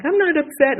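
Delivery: child insisting through tears, half-acted; retold tearful protest. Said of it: "And I'm bawling".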